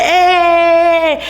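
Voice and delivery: high excited party cheer; crowd's excited hey. Retold or imitated. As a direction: call it out like the crowd.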